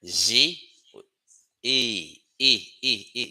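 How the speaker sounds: eh with mouth open, no final E glide; teacher isolates the eh sound. Shown as a demonstration. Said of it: "You open your mouth".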